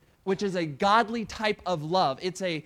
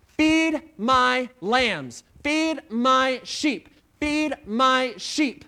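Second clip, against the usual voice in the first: slow solemn charge; 3 semitones higher, 5 dB louder, much slower than usual. Acted. Jesus' charge, drawn out.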